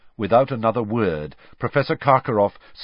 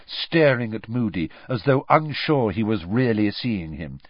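usual speaking voice

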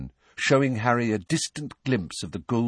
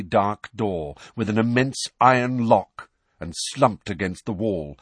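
ordinary delivery

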